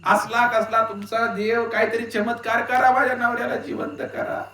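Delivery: high, stretched wailing plea to the guru; loud mock wailing. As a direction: widow wails and begs the guru. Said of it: "मोठ्यानं रडायला सुरू केलं".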